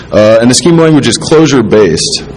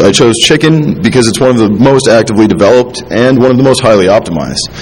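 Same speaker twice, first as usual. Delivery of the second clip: ordinary talk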